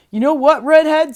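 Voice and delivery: donkey hailing the rooster, loud and hearty; donkey calling out. A narrator's reading. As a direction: call to the rooster boldly. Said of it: "said the donkey".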